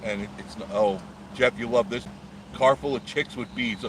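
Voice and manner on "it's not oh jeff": ordinary delivery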